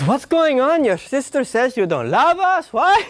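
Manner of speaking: high, worried mom voice climbing to What; mom's alarmed voice. Retold or imitated. Mother calling her son in alarm, high and worried. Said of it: "She goes".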